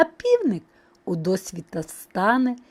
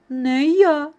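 loud little mouse refusal; calling out; 4 semitones higher, 5 dB louder than usual. A narrator's reading. Second mouse refusing too, calling out. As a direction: call it out, slightly high. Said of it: "гукає Верть".

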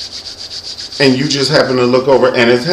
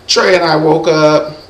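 ordinary talk, nothing marked

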